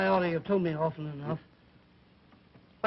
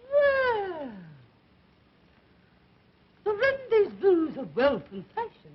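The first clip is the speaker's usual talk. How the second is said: mocking reading; 9 semitones higher, 3 dB louder, slower than usual.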